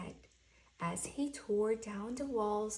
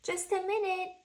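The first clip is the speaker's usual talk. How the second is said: lightly voiced Owl, higher and louder; Owl calling; 9 semitones higher, 6 dB louder, faster than usual. Owl answers the door, a lightly voiced call.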